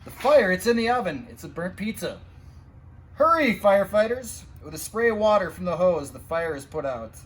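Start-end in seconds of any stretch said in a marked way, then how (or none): 0.0-1.3 excited discovery. A narrator's reading. Fire found at pizza parlor. 3.1-4.4 loud, eager cheer; urgent call. A narrator's reading. Urging the firefighters on.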